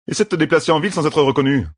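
grumbling rogue. Acted. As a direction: toss back an irritated retort. Voices Matt complaining.

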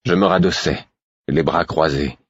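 ordinary delivery

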